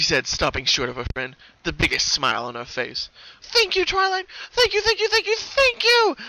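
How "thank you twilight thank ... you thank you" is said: very high, loud gushing repeats; high, loud, slowing gratitude; gushing thanks. Acted. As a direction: hug her friend tight. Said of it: "mighty squeezing hug".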